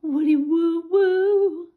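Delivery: high, loud sung woo; excited cheer. Real, not acted. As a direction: cheer the bird on. Cheering the bird on.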